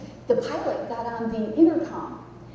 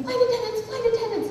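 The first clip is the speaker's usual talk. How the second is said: urgent high cry to the crew; imitated screaming; 11 semitones higher than usual. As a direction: mimic a frantic captain on the intercom. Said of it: "he was screaming".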